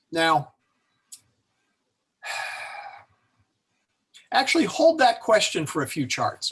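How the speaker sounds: ordinary delivery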